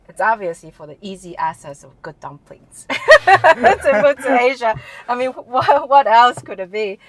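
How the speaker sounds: joking that dumplings drew her to Asia, laughing. Real, not acted. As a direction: tease the answer with a laugh. Joking answer about dumplings.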